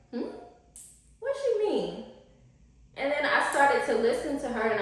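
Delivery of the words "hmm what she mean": high squeaky what she mean; puzzled mock reply. Vlogger retelling her confused reaction to the lady. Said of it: "And I was like".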